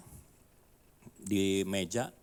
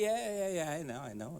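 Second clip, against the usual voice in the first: dismissive; 6 semitones higher, 6 dB quieter than usual. Retold or imitated. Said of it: "Dia bilang gitu".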